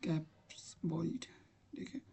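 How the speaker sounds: barely voiced aside to yourself; whispered aside. Real, not acted. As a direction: whisper to yourself while typing. Muttering while typing on screen.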